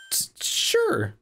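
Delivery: high trembling stammer; scared. Acted. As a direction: stutter out of fear. Maya frightened.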